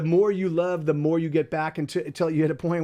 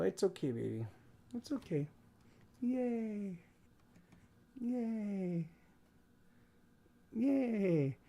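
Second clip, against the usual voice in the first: soft hushed reassurance; gently calm the little puppy; soothing the dog; 3 semitones higher, 10 dB quieter, much slower than usual. Real, not acted. Owner hushing her squirming dog, soft and soothing.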